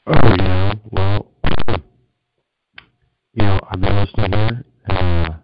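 too close to the mic, muffled and blown out; distorted phone. Real, not acted. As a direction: mouth right on the phone, overloaded. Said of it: "it sounded like you were kinda snoozing on the phone there".

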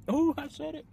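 high squeal at her own slip; surprised squeal. Real, not acted. Vlogger catching her own slip to viewers, squealing high. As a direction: yelp as if she let a secret out. Caught herself saying mango.

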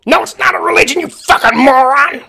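put-on very loud, high-pitched furious yell in an American accent; exploding American rage. Long-haired man blowing up at Hamish, loud American fury. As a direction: explode at him, screaming the insult. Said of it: "suddenly exploded loudly in accents equally American".

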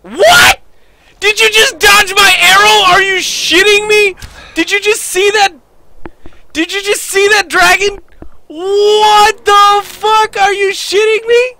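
very high, loud, squealing disbelief; incredulous screaming. Real, not acted. Gamer whose arrow the dragon dodged, incredulous scream. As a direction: shriek each question in total shock.

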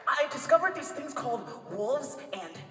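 put-on high, loud excited kid voice; Hunter voice. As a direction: gush about it, high and loud. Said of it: "Hunter from Owl House".

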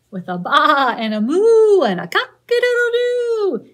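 loud and high sing-song refrain; cheerful shouted chorus; big refrain. Acted. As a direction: belt the refrain out to the children. Refrain of picture book.

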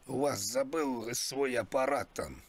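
ordinary delivery

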